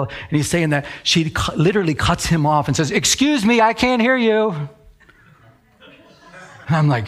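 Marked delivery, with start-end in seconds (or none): 2.9-4.5 high-pitched mock complaint; put-on higher voice, interrupting; imitated interrupting woman. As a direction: cut in as an indignant objector. Retold woman interrupting the official.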